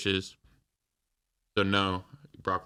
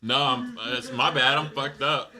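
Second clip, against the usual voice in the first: ordinary talk, nothing marked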